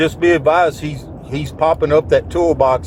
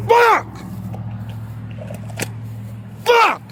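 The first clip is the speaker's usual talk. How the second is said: high desperate curse; anguished cry; 3 dB quieter than usual. Real, not acted. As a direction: cry out in shock. After shooting.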